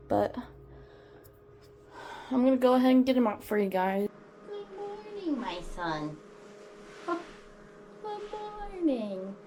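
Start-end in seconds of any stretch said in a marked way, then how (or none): 4.5-6.2 very high, soft singsong, falling at the end; cooed pet greeting. Real, not acted. Waking the pet snake. 7.1-9.4 slow, high, soft singsong; cooed pet greeting. Real, not acted. Waking the pet snake.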